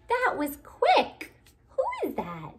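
high, loud surprised squeal. Acted. Surprised reaction reading to child.

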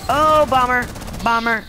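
sharp loud callout, high; shouted warning. Real, not acted. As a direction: yell the warning to the team.

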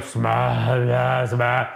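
slurred, half-formed SMASH; garbled Hulk. Retold or imitated. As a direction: fumble for the word like a dazed brute. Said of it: "I believe the word is smash".

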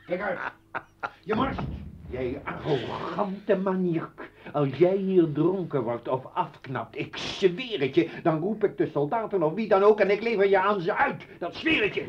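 husband threatening to call the soldiers; furious threat. Acted. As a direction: warn him in a rage.

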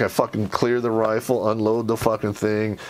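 ordinary delivery

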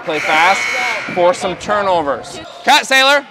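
ordinary talk

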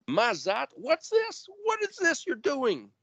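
very high, incredulous rebuke; rebuking voice. Acted. God rebuking the fathers, high incredulous scold. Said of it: "And then it says, Mazat Ashitam".

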